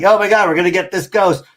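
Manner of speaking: fast, high, loud ghost-hunter thrill, lightly voiced; mock excitement. Ghost hunters psyched about catching a ghost, mock excitement. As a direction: gush like an eager ghost hunter on the hunt. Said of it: "Zach, Nick, and Aaron are like".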